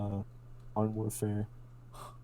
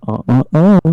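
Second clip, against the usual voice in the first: loud, higher finger-wagging tut; mock scolding; 4 semitones higher, 21 dB louder than usual. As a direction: scold loudly, one beat per finger wag. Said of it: "wagged their big floppy fingers".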